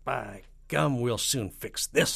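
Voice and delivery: a touch of presidential irritation, drawn out; mild snap. Acted. President resolving to fix it, mildly snapping. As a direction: snap it with slow, pushed resolve. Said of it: "snapped the president".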